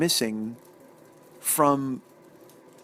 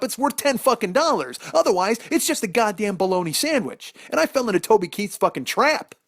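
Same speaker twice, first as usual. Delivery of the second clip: loud, high-pitched ranting outrage; indignant comic rant; 6 semitones higher, 4 dB louder than usual. Acted. Comic ranting about a ten dollar sandwich, loud indignation. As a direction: rail at the outrageous price, raised and forceful.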